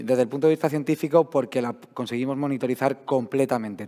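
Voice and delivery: ordinary delivery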